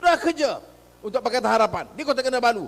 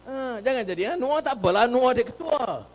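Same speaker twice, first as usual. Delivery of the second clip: ordinary delivery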